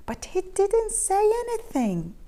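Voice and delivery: plain reading voice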